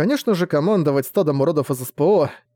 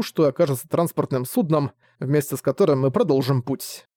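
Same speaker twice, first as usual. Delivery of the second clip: ordinary delivery, nothing marked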